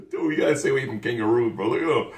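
ordinary talk

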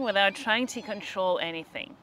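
normal talking voice

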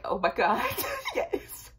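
words breaking into a squeaky laugh; giggling panic to the officer; nervous laughing yes. Retold or imitated. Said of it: "I was like".

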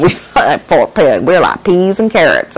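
put-on slow Southern accent; Forrest Gump. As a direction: drawl it in a playful Southern accent. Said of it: "like Forrest Gump".

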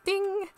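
a high bright "Ding!" like a bell; playful bell sound; imitated ding. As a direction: chime it out.

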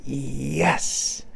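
hushed, drawn-out little cheer; a yell held down to storytelling volume; mock yell. A narrator's reading. Little boy cheering his dog's catch, soft mock yell. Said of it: "and yelled".

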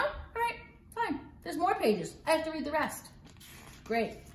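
plain reading voice

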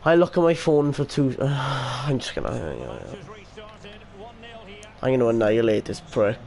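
ordinary delivery

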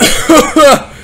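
a loud, high laugh; high burst of laughter. Real, not acted. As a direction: laugh off the fright. After a scare.

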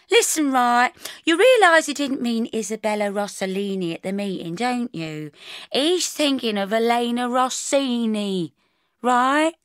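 a touch high, questioning colleague; lightly voiced colleague, explaining. Colleague setting Bridget straight about a name, lightly voiced and insistent. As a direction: explain earnestly, seeking agreement.

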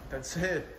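ordinary talk

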